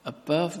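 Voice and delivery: "Above" lifted high and held; stress on above. Shown as a demonstration. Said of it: "Listen to these words".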